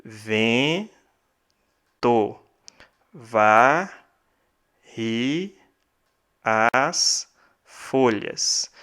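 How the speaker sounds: slow, each poetic syllable set apart with pauses; split into syllables. Shown as a demonstration. Teacher scanning a verse for students, slow syllable by syllable. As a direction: scan the verse syllable by syllable, softly. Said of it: "Vamos dividir em sílabas poéticas".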